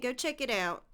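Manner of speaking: ordinary talk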